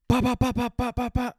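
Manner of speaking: loud, high rapid-fire ba-ba-ba gunshot sounds; mock gunfire. Telling friends about the shooting, loud mock gunfire. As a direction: rattle off the shots like a machine gun.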